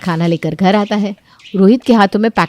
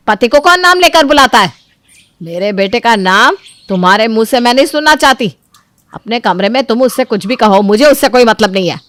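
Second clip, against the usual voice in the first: angry scolding; 3 semitones higher, 6 dB louder than usual. Acted. Mother-in-law scolding Chanda for using husband's name, angry. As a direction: scold her harshly.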